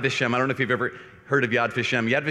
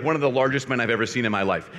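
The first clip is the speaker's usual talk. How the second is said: ordinary delivery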